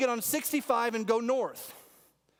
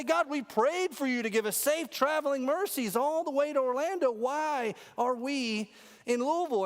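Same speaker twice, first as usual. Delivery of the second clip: higher mock-frustrated plea to God; frustrated complaint; 6 semitones higher than usual. Traveler blames God for Louisville, higher, mock frustrated. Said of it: "you get frustrated and say".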